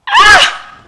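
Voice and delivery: loud, very high shriek of outrage; outraged shriek. Acted. Mother hearing her daughter trusted the boy, an outraged shriek. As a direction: shriek in disbelief.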